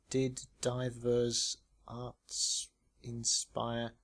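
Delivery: each word drawn out slowly; puzzling out the letters; slow deciphering. Acted. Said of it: "tried to decipher".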